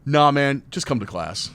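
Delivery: loud casual dismissal on nah; cool brush-off. Retold or imitated. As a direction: wave them off, easy and cool. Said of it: "I'm like".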